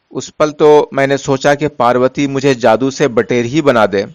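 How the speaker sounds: plain reading voice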